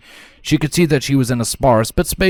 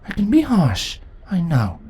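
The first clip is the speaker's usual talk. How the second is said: ordinary delivery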